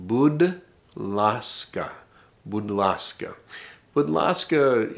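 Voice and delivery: Budlaska stretched very slowly, syllable by syllable. Shown as a demonstration. Teacher models Budlaska slowly for the class. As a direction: draw out Budlaska slowly for learners. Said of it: "you would use".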